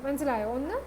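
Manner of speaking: ordinary talk, nothing marked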